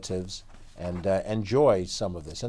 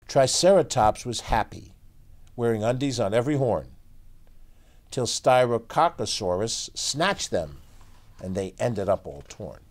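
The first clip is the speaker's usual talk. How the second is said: put-on lively read-aloud for kids; story narration; 5 semitones higher, 4 dB louder, slower than usual. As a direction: tell it like a bedtime picture book. Reads picture book aloud.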